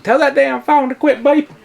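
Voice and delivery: high squeaky complaint; high mock voice. High joking aside.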